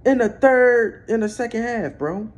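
loud, high-pitched outraged rant; pissed off. Real, not acted. Fan raging at the Ravens over who gets the ball, pissed off. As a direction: demand answers in a real angry yell. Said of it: "I'm pissed off".

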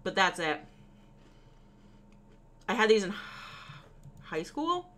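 talking while crunching chips. Real, not acted. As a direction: mouth full of chips. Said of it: "Sorry for the crunch".

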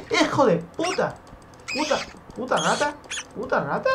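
high-pitched outburst of swearing; startled angry cursing. Real, not acted. Player cursing a rat that attacked, high angry yelps. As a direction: curse at the thing attacking you, voice jumping up.

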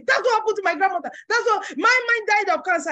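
fast gossiping neighbour; mock gossip. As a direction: rattle it off like a gloomy gossip. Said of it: "And they say".

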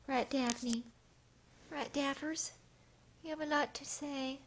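high, cutesy questioning; high sing-song talk to a cat; coaxing pet talk. Real, not acted.

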